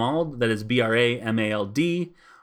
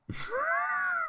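shrieking laugh; 8 dB quieter than usual. Real, not acted. Bursts out at pun game.

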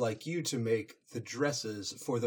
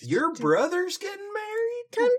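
high squeaky shocked question; shocked disbelief; 4 dB louder than usual. Acted. Shocked Twilight.

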